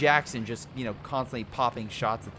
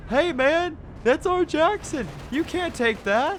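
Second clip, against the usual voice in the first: much higher, louder squeaky cartoon crew voice; mock repair crew; 6 dB louder, slower than usual. Caster voicing the repair crew chasing their stolen Jackson, high cartoon whine. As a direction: play the upset repair crew, pitched way up and slowed.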